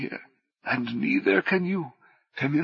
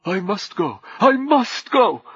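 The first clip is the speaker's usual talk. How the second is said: urgent shout; 3 semitones higher, 7 dB louder than usual. Acted. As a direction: build from firm to a shout.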